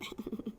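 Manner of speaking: short, soft chuckle; real, quiet little laugh. Real, not acted. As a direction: laugh softly to yourself. Jokes about growing old.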